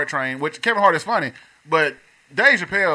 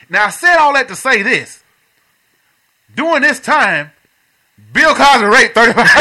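louder buildup to the punchline; comedian punchline; 4 semitones higher, 5 dB louder than usual. Retold or imitated. Retelling a comedian's joke to friends, with mounting buildup. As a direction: land the reveal slowly. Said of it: "And he said".

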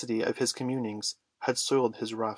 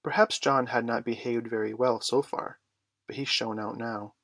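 usual speaking voice

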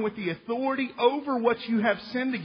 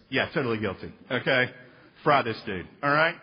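mock lawyer; 8 semitones lower than usual. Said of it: "your lawyer ... goes".

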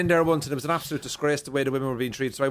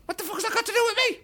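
fast, high, exasperated retort; much faster than usual. Retold or imitated. Comedian snapping fast and high at a punter. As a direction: fire it back fast and high. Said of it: "I go".

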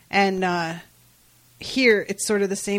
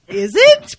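high, loud mock doubt; loud, high-pitched retold disbelief; incredulous question; 5 semitones higher, 12 dB louder than usual. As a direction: pitch it up sharply in exaggerated doubt. Acts out own doubting reaction.

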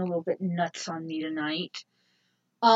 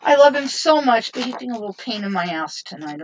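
ordinary delivery

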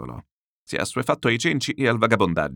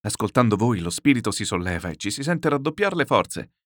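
plain reading voice

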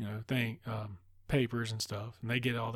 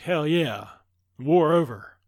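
loud, higher victory cry; mock triumph; 4 semitones higher, 9 dB louder, slower than usual. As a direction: crow it loudly as if the fight is won. Acts Diana's victory cry.

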